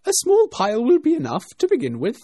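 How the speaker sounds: high, loud, commanding rabbit; rabbit's voice. A narrator's reading. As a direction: give the order as a bossy rabbit. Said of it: "she heard the rabbit say".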